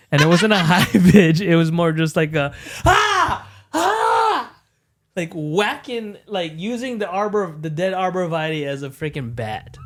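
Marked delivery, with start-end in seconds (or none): 2.8-4.5 mock yell. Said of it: "that's how loud I screamed".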